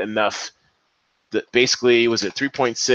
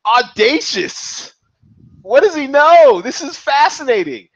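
amazed; 12 semitones higher, 5 dB louder than usual. Real, not acted. Host amazed by pick.

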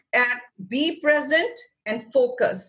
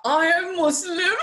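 indignant; 8 semitones higher than usual. Retold or imitated. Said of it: "I was like".

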